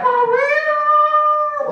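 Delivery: very high, incredulous squeal; drawn-out falsetto disbelief; amazed admirer. Acted. Gym admirer amazed at his buddy's muscles, incredulous.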